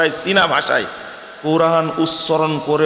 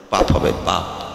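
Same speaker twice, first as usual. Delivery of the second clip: hushed warning; 7 semitones lower than usual. Acted.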